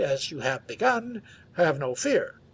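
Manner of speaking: usual speaking voice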